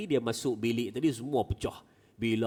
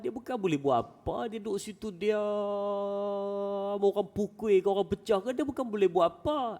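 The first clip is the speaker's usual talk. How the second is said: mocking explanation; 9 semitones higher than usual. Cousin mocks the idols.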